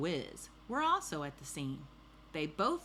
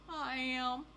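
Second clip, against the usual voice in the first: high-pitched meek little admission; lightly voiced storybook thief owning up; confessing thief; 8 semitones higher than usual.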